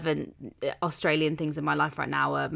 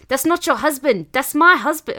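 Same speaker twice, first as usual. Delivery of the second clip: loud, high mock Australian protest; hammed-up Australian wife claiming her husband; Australian accent; 8 semitones higher, 9 dB louder than usual. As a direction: imitate an indignant Aussie reality-show contestant. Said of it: "I like on Married at First Sight Australia, she's like".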